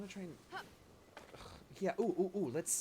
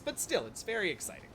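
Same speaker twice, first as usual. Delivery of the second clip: ordinary talk, nothing marked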